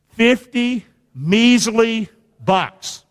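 slow, drawn-out fifty measly bucks; stressed slogan. Shown as a demonstration. Preacher drills the crowd, very slow. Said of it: "Say it with me".

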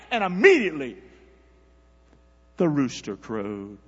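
loud, high preaching read; preaching scripture. Acted. Preacher reading Peter's denial to church, loud. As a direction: preach the passage with fire.

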